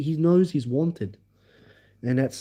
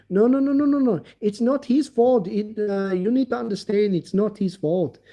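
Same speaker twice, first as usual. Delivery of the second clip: mocking Uber; 7 semitones higher than usual. Imitating Uber defending driver.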